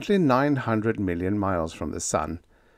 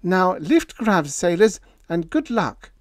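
much higher, louder scolding wife; firm wife; 10 semitones higher, 4 dB louder than usual. Acted. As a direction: lecture him on duty firmly. Diane's voice in the story.

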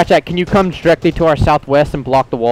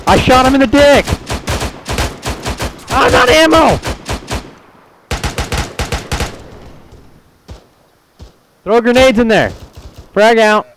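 combat shouting; 10 semitones higher than usual. Real, not acted. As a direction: shout over the gunfire. Firefight, shouting to squad.

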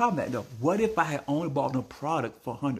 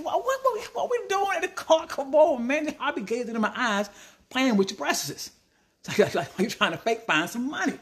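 exasperated disbelief; 11 semitones higher than usual. Retold or imitated. Ranting at lady stalling line.

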